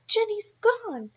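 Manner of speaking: high, slow alarmed shout. Acted. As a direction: shout in a panic for the lost snake. Emily shouts about her snake.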